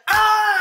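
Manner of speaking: high, loud scream; sudden loud yell; demonstrated scream. Shown as a demonstration. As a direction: let out a full high scream. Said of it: "this was more of like".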